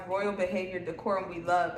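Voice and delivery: ordinary delivery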